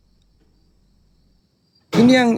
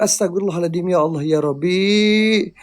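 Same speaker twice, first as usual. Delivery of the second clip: murmured prayer; 6 semitones lower than usual. Real, not acted. Frightened prayer at haunted coffin.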